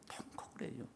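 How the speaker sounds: breathy hushed whisper. Acted. Preacher repeating Peter's weeping: hushed whisper. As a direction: drop to a whisper of grief. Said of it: "통곡을 합니다".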